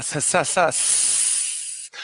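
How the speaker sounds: sa sa sa sliding into a voiceless hiss; breathy sibilant wind imitation. Shown as a demonstration. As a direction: imitate the wind with a soft fading s. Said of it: "el efecto de viento".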